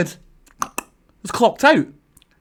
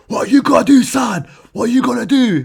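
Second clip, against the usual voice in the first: put-on loud, higher wrestler taunt in the kid's face; loud wrestler heel taunt; 7 semitones higher, 6 dB louder than usual. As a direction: bark it to intimidate the kid. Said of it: "get my intimidation factor back".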